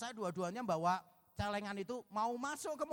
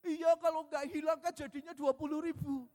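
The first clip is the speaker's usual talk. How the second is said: put-on childish whine; 7 semitones higher, 4 dB louder than usual. Child explains, higher voice.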